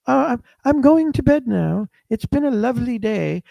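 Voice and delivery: high-pitched mock Queen's English; proper Queen's English. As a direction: put on prim, formal Queen's English. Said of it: "she will speak the proper Queen's English".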